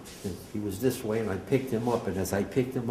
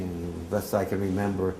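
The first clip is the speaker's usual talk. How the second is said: ordinary talk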